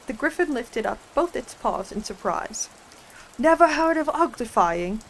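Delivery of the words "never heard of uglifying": loud, high disbelieving cry; exclaiming. Acted. Griffin astonished Alice never heard the word, loud exclaiming. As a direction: gasp at her ignorance.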